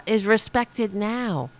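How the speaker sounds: ordinary delivery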